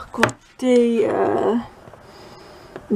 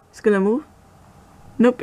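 ordinary delivery

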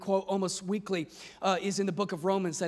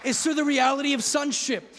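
high, loud, building sermon delivery; full-force preaching; 5 semitones higher, 7 dB louder than usual. Real, not acted. Preacher declaring freedom to the congregation, full-force preaching. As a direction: preach it out, rising to a shout.

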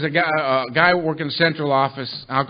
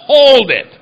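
loud alarmed stop; 8 semitones higher, 10 dB louder than usual. Retold or imitated. Reader stopping in alarm.

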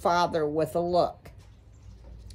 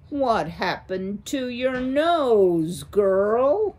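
slow, louder, looming owl voice; menacing owl; 4 semitones higher, 5 dB louder, slower than usual. Acted. A great horned owl questioning a frightened mouse, slow menace. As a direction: loom over the girl, slow and threatening.

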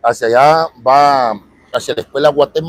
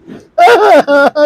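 hammed-up fake crying wail, much higher and louder; mock sob; 13 semitones higher, 7 dB louder than usual. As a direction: fake a sob over bad sales. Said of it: "Sí, estoy llorando". (llanto fingido y exagerado)

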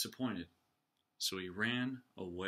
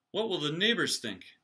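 higher, louder fretful cry; worried; 6 semitones higher, 5 dB louder than usual. Acted. Mother fretting about the neighbors, worried. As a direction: fret aloud in a panic. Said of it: "worried his mother".

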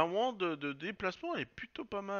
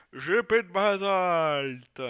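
loud, drawn-out, higher-pitched cry; loud outcry; 4 semitones higher, 14 dB louder, much slower than usual. Real, not acted. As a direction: bellow it slowly, stretching the words. Realises he lacks basalt. (grand cri traînant)

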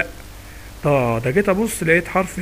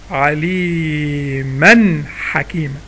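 ترتيل بالإظهار؛ "عليمًا حكيمًا" بطيئة مرتلة وتنوين ظاهر قبل الحاء؛ رتّلها ببطء وأظهر النون؛ تلاوة بإظهار التنوين; 3 semitones lower, much slower than usual. Shown as a demonstration. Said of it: "نطقت التنوين ولا ما نطقتوش؟".

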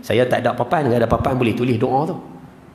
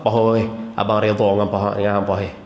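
lower, hesitant, fumbling for words; hesitant husband; 5 semitones lower, faster than usual. Retold or imitated. A hint of a husband dodging his wife's question, lower and fumbling. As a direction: stall like a man caught off guard. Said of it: "Kalau dia sebut apa?".

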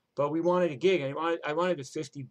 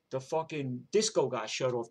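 normal talking voice

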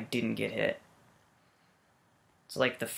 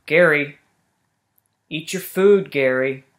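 scolding pet; 5 dB louder than usual. Acted. Scolding pet snail louder.

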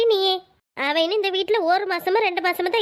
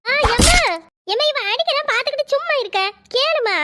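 whiny complaint to mother; 5 semitones higher than usual. Acted. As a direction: wail to mother that she's being hit. Sister whines she is being hit.

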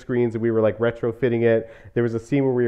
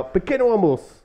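high-pitched mimicked European Portuguese accent; imitated Portuguese accent; 9 semitones higher than usual. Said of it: "who has a really Portuguese accent".

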